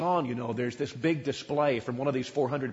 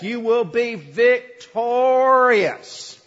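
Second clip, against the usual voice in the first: grand prophetic promise; 7 semitones higher, 7 dB louder, much slower than usual. Acted. False prophets promising the king victory.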